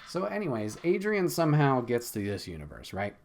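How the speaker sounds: ordinary delivery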